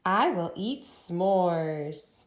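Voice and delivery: very slow, word by word; very slow read-aloud. Shown as a demonstration. Teacher reading sentence aloud with class. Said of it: "Remember we pause at a period".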